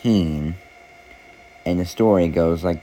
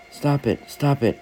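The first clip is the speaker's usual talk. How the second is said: raised, higher mock cry of "stop it"; a teacup begging the potter to stop, pitched up; pleading cry; 8 semitones higher than usual. A narrator's reading. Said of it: "I cried".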